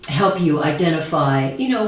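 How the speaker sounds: usual speaking voice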